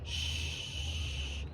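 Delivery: soft unvoiced shh; shushing. Shown as a demonstration. Hushing the negative voices inside, a soft shh. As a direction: hush the voices in your head. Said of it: "I just tell them".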